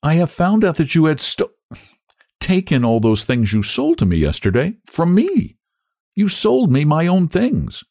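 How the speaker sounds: high-pitched, aggrieved accusation; indignant reproach, slowing at the end; accusing. A narrator's reading. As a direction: confront the child, pitch lifted. Sarah confronts the child over theft.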